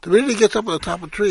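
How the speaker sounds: normal talking voice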